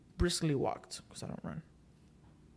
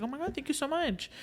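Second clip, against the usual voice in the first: much higher, gushing thank-you; grateful lady; 9 semitones higher, 5 dB quieter than usual. Retold or imitated. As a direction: gush like a relieved official. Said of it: "she's like".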